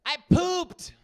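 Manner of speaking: put-on loud, high kid announcement; mock toddler blurting proudly to Dad; imitating toddler.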